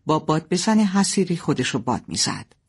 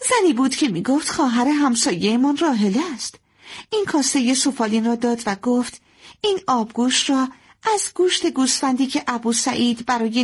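higher-pitched, lively daughter voice; young daughter reporting; 7 semitones higher than usual. Acted. Daughter telling mother who was at the door, higher lively voice. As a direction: report the news in a higher girl's voice.

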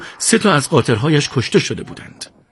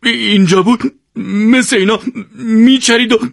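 sobbing, hiccuping; 11 semitones higher, 4 dB louder than usual. A narrator's reading. Siavash weeping for the lost mules, hiccuping through tears. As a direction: choke on the sobs. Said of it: "سکسکه کنان گفت".

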